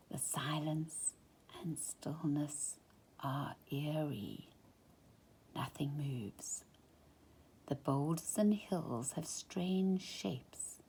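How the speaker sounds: a hushed storyteller's whisper for the eerie stillness. A narrator's reading. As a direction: whisper it as if the silence might break. Eerie silent moonscape described.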